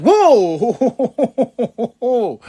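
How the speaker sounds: loud, high, drawn-out laughing whoa; stretched-out whoa breaking into laughter; amazed whoa. Retold or imitated. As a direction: gasp out a long amazed whoa. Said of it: "I was like".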